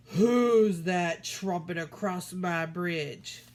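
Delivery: slow, loud troll growl; troll challenging the big goat, slow and loud; menacing troll. Acted. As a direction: rumble it out like a troll guarding his bridge.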